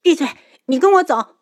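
high, loud, fast order; angry command. A narrator's reading. Daughter ordering Yunchuan to follow her, angry. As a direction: shut him up angrily. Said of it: "愤怒异常".

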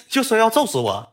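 amused, high-pitched through a laugh. Real, not acted. Storyteller recounts being threatened with a beating, laughing. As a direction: laugh while recalling the threat.

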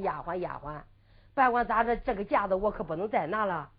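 压低嗓子自己嘀咕; 轻声对自己说心里话; 自言自语. Acted. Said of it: "丫鬟自叫自鸣". (低声自语)

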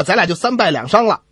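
ordinary talk, nothing marked

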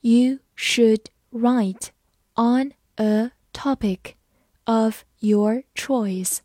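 slow, phrase by phrase; slow, chunked model reading. Shown as a demonstration. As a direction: read it slowly in chunks for learners. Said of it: "慢慢来读".

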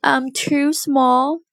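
high, slow little complaint; mild wail. A narrator's reading. Puppy complaining about being too small, high mild wail. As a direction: pitch it up and draw out the wail. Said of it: "wailed the puppy".

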